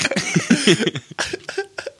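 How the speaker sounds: very high helpless laugh; high squealing laugh. Real, not acted. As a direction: shriek with laughter. Laughing fit continues.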